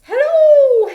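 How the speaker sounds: very high, loud hooting hello; head voice demo for a kids' choir. Shown as a demonstration. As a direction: float it way up in head voice. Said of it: "head voice".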